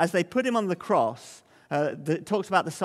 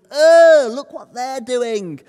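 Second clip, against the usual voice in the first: disgusted friend; 6 semitones higher, 11 dB louder, much slower than usual. Acted. Friend pointing and jeering.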